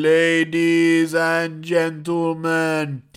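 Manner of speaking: slow, drawn-out announcer's call; ring announcer. Retold or imitated. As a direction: imitate a ring announcer stretching every word. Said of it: "dude that's doing the intro going".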